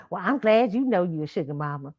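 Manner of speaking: ordinary talk, nothing marked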